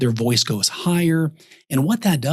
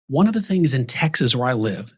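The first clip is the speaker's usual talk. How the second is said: ordinary delivery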